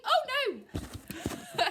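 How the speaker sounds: loud, high laughing cry; real startled oh no; sudden dismay. Real, not acted. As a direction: exclaim as something goes wrong. Reader's alarmed interruption.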